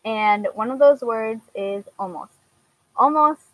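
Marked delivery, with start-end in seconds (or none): 2.0-2.3 slight German accent on "almost"; real German-accented English. Real, not acted. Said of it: "makes my German accent come out". 3.0-3.4 higher, louder German-accented "almost"; real German accent, word held up high. Real, not acted. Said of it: "I can never say it with a regular".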